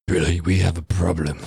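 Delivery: low, grave warning; deep mock-serious warning; ominous mother. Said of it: "Bonnie in the background".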